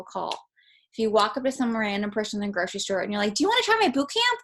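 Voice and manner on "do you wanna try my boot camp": overeager sales pitch. Retold or imitated. Pushy pitch to a stranger.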